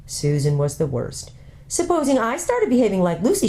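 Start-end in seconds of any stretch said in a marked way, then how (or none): 1.7-3.5 put-on higher, peevish older girl; peevish sister. Older sister threatening to stay behind, peevish. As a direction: needle her sister.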